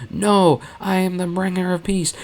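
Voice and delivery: high mock-defiant cry; a hint of a zealot's scream. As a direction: Mon defying the guards with a mild mock scream. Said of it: "Mothma screamed".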